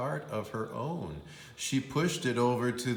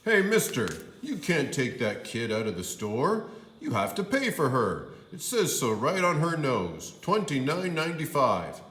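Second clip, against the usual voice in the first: louder, insistent cashier; a hint of a pushy clerk; cashier calling out; 5 semitones higher, 5 dB louder than usual. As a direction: stop the dad leaving.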